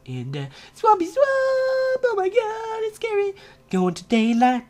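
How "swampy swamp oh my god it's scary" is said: high squeaky mock fright; mock scared. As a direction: squeak it like you're spooked.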